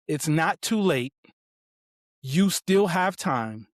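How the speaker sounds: retold reassurance, drawn out and measured; slow, emphatic encouragement; slow, deliberate quote. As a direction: space out each phrase for weight. Retold son's three solemn sentences.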